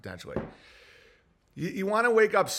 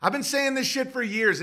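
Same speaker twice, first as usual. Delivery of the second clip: ordinary delivery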